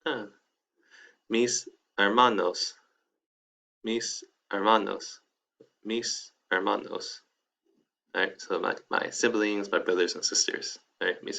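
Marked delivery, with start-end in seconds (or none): 1.3-7.2 "Mis hermanos" drawn out slowly for students; slow model. Shown as a demonstration. Teacher repeating the word to the class, slow and careful. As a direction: stretch each syllable of "Mis hermanos". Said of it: "teacher models slowly for students".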